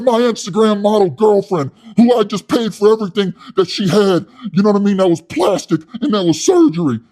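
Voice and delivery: high, loud, puffed-up yelling; loud hothead Wes. Retold or imitated. Wes scolding his soy boy cellmate, loud and high. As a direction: rant like a tough guy with something to prove. Wes ranting about girlfriend.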